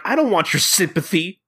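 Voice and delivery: high-pitched mock pout; lightly acted petulant refusal; Kagura. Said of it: "And Kagura's like".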